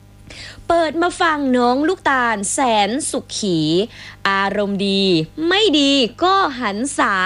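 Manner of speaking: poem reading. Acted. Reads listener's rhyming poem aloud.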